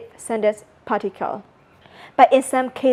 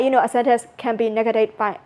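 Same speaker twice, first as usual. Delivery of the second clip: usual speaking voice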